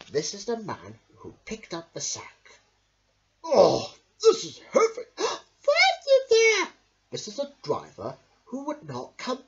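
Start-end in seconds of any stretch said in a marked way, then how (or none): 3.4-5.2 very high, loud strained cry; squeaky straining voice; straining man. Acted. Man lifts heavy sack. 5.2-6.7 very high, loud call; squeaky calling voice. Acted. Boy calls for bear.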